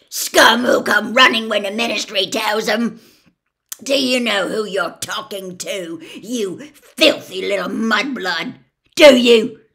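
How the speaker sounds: very loud, hateful tirade; furious abuse. A narrator's reading. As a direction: advance on him spitting the insults. Gaunt abuses Ogden.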